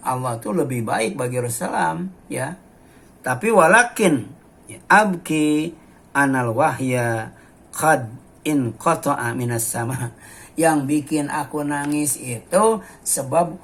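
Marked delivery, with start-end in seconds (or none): 3.5-10.1 louder, declaimed Arabic recitation; hadith recitation. Acted. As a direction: proclaim the Arabic text to the congregation. (membaca hadis dengan lantang)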